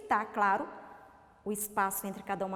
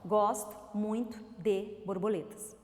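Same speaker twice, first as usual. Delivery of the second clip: "Gosto muito de borboletas" with a clear pause between words; 3 semitones lower than usual. Shown as a demonstration. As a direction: read "Gosto muito de borboletas" smoothly within each word, spaced between them. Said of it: "Quantas palavrinhas, ó?".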